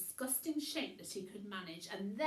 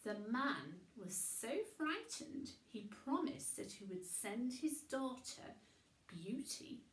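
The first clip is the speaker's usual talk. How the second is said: usual speaking voice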